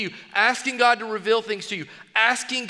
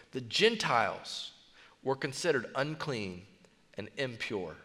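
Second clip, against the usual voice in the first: much softer and lower, almost a murmur; dropped to a hush; 7 semitones lower, 10 dB quieter than usual. Real, not acted. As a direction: lean in and say it quietly. Sermon drops for serious point.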